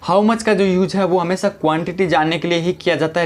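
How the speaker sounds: ordinary talk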